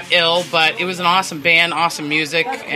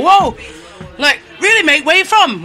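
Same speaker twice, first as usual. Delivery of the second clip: loud, high mock Aussie greeting; Australian accent; 9 semitones higher, 5 dB louder than usual. Teasing friends recall the real Australian couple, loud, high mock Aussie accent. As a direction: ham up an excited Australian, higher and louder. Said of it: "pretend we were from Australia".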